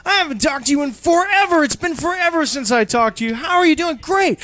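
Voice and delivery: high, loud, gushing reunion; hammed-up delight at an old friend; both friends gushing on the phone, excited; mock excited reunion. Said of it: "And you're like".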